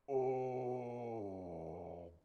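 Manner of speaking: long, loud, sinking "oh"; giant's dying groan. Acted. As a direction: groan as you topple slowly over. Goliath hit by the stone.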